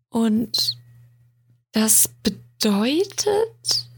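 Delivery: slow, drawn-out doubtful question; skeptical murmur. A narrator's reading. Luna skeptically presses William, slow murmured question. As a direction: let the question hang, arms crossed. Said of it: "murmelte sie".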